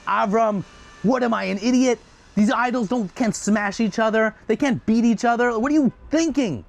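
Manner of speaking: high, loud angry scolding; furious father. Acted. Father scolding his son for blaming the idol, furious. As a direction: tear into him, red in the face. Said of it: "Terach's face is turning purple".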